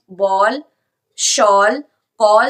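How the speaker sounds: teacher modelling a vowel; aw sound. Shown as a demonstration. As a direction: stretch the aw in "शॉल". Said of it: "ये ओ की आवाज निकाल रहा है".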